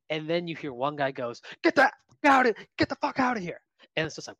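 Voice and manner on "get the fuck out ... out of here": high, mock-furious outburst; imitated angry heckle. One Cal fan to hat-waving old man, high angry heckling. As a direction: blurt it high, like an irate fan.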